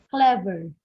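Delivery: "clever" with a loud CLE; stress on first syllable. Shown as a demonstration. Teacher listing two-syllable adjectives, first-syllable stress. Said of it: "stress here is found in the first".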